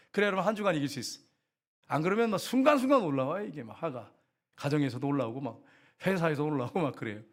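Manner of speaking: ordinary delivery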